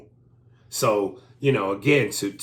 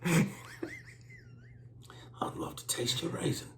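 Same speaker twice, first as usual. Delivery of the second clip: much softer hushed line; breathy mock seduction; seductive whisper; 11 dB quieter than usual. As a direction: whisper it lewdly.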